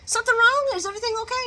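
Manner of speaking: very high, put-on voice; hammed-up anxious questions, high pitched; mock concerned woman. Woman checking on Chase at hospital, high mock concern.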